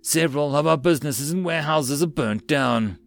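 ordinary delivery

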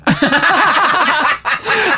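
a burst of high laughter; real delighted laugh. Real, not acted. Laughing at the story.

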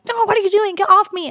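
high, protesting shocked man; injured rider refusing help, mock-shocked. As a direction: push back like a dazed victim. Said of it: "in total shock".

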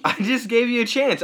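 high, loud comeback; insistent protest. Real, not acted. Host insisting he let co-host talk, loud protest. Bickering over who talks.

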